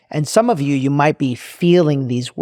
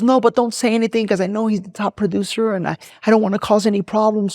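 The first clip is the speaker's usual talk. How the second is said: higher-pitched, mock-upset pleading; imitated tearful plea; 7 semitones higher than usual. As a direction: play a tearful assistant begging for no fuss. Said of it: "she's crying, right?".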